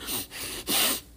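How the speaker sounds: sharp sniff through a blocked nose; one wet sniffle; loud sniff. Real, not acted. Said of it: "if you hear me breathe".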